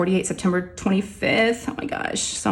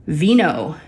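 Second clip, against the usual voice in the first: usual speaking voice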